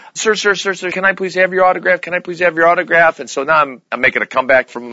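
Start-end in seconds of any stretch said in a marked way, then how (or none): 0.1-3.2 put-on higher, pleading little girl's voice, lightly done; eager young girl. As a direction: beg him for the autograph like a keen kid. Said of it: "this young girl, she had to be maybe eleven or twelve".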